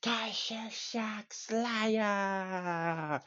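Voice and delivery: high, very drawn-out mock wail; hammed-up imitation of staged agony; pretend defeated cry. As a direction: stretch the name out in a high put-on wail. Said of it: "pretends to throw him".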